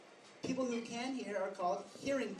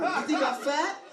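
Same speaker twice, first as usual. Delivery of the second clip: louder, higher mock outrage; offended date; 6 semitones higher, 5 dB louder than usual. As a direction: act out an offended date, pitched up and loud. Retold girl, offended after the drink.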